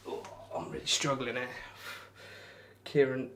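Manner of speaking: ordinary talk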